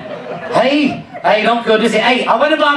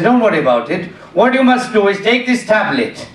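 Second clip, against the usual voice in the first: put-on Indian doctor's voice; Indian accent; 2 semitones lower than usual. Doctor prescribing a tablet, Indian accent. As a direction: give instructions in a mock Indian accent. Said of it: "He was an Indian doctor".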